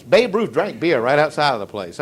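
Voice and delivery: ordinary talk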